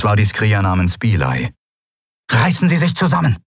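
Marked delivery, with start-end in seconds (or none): 2.3-3.4 high, fast order; sharp command. A narrator's reading. Female warrior orders wounded Kai to pull himself together, sharp. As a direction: snap at him in a raised pitch.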